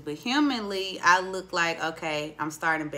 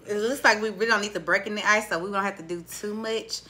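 usual speaking voice

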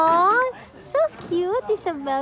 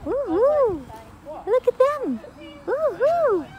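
ordinary talk, nothing marked

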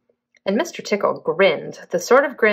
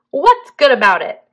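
grumpy; 5 semitones higher, 6 dB louder than usual. Acted. Mister Grumpy grumbles.

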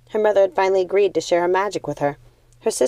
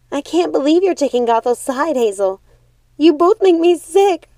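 higher and louder, shouted in disgust; furious outburst; 9 semitones higher, 5 dB louder than usual. Acted. Primrose lashes out at her sisters before storming off, furious. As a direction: explode at both sisters. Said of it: "Primrose stormed off".

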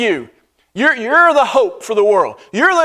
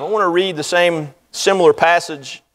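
ordinary talk, nothing marked

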